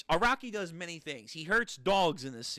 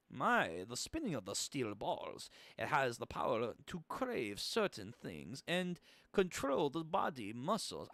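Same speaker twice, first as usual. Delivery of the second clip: low, soft, bad German accent; terrible German accent; 3 semitones lower, 7 dB quieter than usual. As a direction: put on a clumsy German accent. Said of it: "I'm gonna do a terrible German accent".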